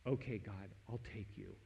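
quiet surrender. Retold or imitated. Moment of surrender recalled.

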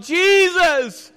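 loud high call of "Jesus!"; yelling out. Retold or imitated. The Marys call out at the tomb, loud yell. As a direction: cry "Jesus!" out at full voice. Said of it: "be able to yell out".

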